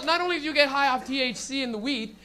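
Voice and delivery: ordinary delivery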